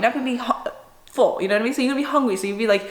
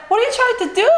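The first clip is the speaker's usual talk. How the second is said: much higher, indignant demand; exasperated high challenge; 13 semitones higher, 3 dB louder, faster than usual. Retold or imitated. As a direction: call him out, pitch jumping up. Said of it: "I was like".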